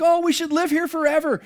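high, loud, gushing excitement; excited Peter. Retold or imitated. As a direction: burst out like an overjoyed disciple. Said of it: "Peter gets so excited".

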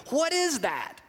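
high-pitched, puzzled question falling away; baffled self-questioning. Acted. Spouse wondering at anger over a brother-in-law, high and baffled. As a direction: leap up in pitch as if searching yourself.

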